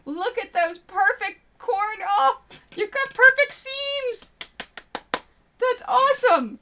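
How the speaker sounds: high, loud gushing praise; excited praise. Real, not acted. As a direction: gush over it, high and loud. Praising viewer's quilt seams.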